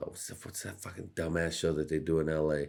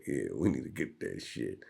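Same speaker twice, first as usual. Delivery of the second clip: mock warmonger; 4 semitones lower than usual. Mocking a dumb hawk who bombs for oil. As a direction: lower gloating mock voice. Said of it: "being like".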